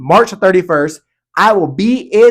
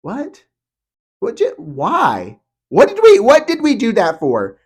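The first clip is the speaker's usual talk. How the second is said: high, incredulous what?; baffled riders; 4 semitones higher, slower than usual. Retold or imitated. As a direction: play the rider who can't believe it. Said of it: "and you're like".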